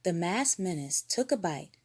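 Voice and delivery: ordinary delivery, nothing marked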